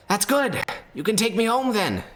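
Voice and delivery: very high, pleading creature voice; a lost monster begging to go home; grotesque monster voice. Acted. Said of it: "and the creature says".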